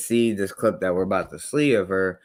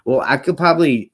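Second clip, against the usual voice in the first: excited; 7 semitones higher, 8 dB louder, faster than usual. Real, not acted. Heated rant about single parenting.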